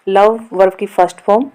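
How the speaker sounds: usual speaking voice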